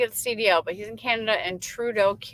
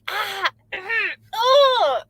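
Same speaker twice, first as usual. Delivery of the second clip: high-pitched exclaimed "ah" and "oh"; mock shocked reaction; 13 semitones higher, 8 dB louder, much slower than usual. Streamer teasing Robin about potty mouth, mock shocked squeals. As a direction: act out a startled gasp, pitched way up, breaking into laughter. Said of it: "I'm just like".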